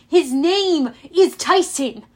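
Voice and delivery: heated word-by-word correction; snapped. A narrator's reading. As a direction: defend your friend indignantly. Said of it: "I snapped".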